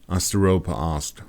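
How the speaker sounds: ordinary delivery, nothing marked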